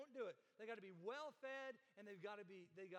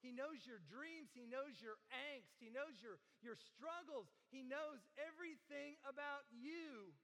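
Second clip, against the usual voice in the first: ordinary talk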